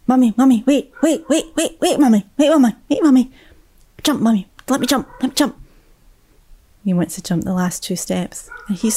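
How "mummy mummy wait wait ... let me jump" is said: put-on much higher, fast little boy pleading; high eager toddler voice; excited child. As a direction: squeal it like a small boy wanting to jump.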